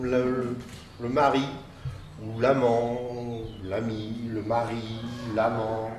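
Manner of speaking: low, halting indecision; hesitant. Acted. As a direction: waver between words, trailing into muddled syllables. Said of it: "bah, vous hésitez". (hésitant, indécis)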